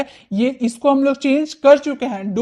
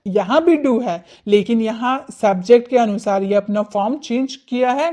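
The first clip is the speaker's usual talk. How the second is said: ordinary delivery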